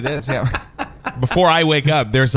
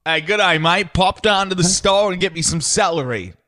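put-on Aussie accent, pitched up; higher Australian-accented request; 5 semitones higher than usual. Shown as a demonstration. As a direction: chirp it like a fake Australian. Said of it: "Let's hear that in your Sha-, Australian accent".

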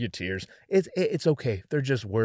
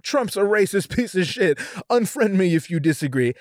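higher-pitched, mock-defiant declaration; mock outrage; 6 semitones higher, 4 dB louder than usual. Mocking people posting to agreeing friends, mock outrage. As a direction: parody a self-righteous online poster.

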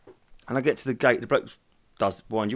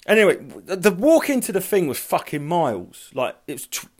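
ordinary talk, nothing marked

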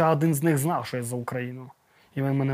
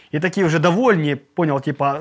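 ordinary talk

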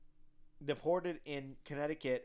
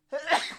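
loud sudden sneeze; sneezing; 12 semitones higher, 8 dB louder than usual. Real, not acted. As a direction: let out a real sneeze. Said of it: "hear me sneeze".

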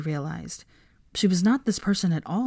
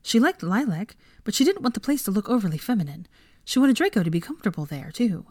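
ordinary delivery

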